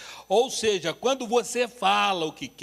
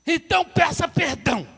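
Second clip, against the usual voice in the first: stern demand; 8 semitones higher, 7 dB louder than usual. Retold or imitated. Wife demanding an apology.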